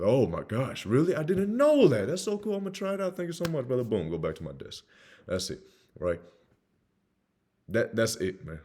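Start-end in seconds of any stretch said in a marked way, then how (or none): 0.0-3.8 high-pitched, hammed-up amazement; feigned surprise. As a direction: gush as if it's all news to you. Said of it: "I act surprised".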